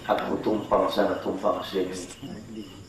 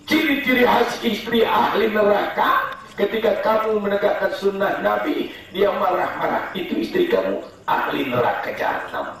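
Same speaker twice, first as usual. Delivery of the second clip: heated preaching; 11 semitones higher than usual. Real, not acted. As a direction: rail against bad wives. Fiery preaching.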